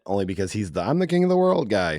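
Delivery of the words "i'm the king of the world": high, hammed-up King of the World cry; lightly mocking movie-star proclamation; mock movie quote. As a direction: belt it out like a cheesy hero. Quoting the Titanic line.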